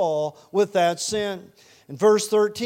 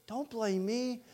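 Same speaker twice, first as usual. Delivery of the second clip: soft, higher-pitched protest; mock innocence; 4 semitones higher, 7 dB quieter, slower than usual. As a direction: plead innocent in a small raised voice. Saul acted shifting the blame.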